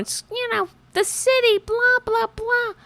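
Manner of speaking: high mocking nag voice, blah blah; mock nagging woman. Mocking the lady who scolded her, squeaky nag.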